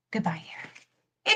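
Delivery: slow breathy whisper, bidding farewell; a slow mock-solemn whispered farewell; whispered goodbye. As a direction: whisper it off to the trash. Tossing old year into trash.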